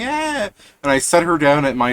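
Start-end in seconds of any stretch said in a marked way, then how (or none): 0.0-0.5 a quick high mock shriek; high-pitched imitated scream; retold high scream. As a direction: yelp it high like a startled girl. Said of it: "she's screaming".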